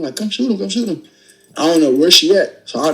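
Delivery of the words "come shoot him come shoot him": high, pleading yell to shoot; retold yell. Wrestling the robber, yelling at his girl to shoot. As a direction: urge her on, pitch up. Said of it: "I'm yelling at him".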